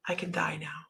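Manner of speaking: sigh, then softly let it out; quiet sigh of relief. Retold or imitated. Client to nail technician after her manicure, quiet sigh of relief.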